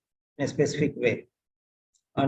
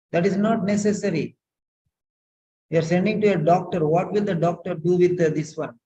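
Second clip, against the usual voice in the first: exasperated teacher; 5 semitones higher than usual. Real, not acted. Teacher scolding student's long note.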